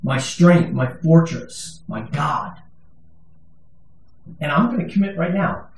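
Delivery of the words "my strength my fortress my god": slow, weighty recitation of the psalm; solemn scripture reading. Acted. Preacher reading Psalm 91 to the congregation, solemn. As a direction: lift the verse up slowly.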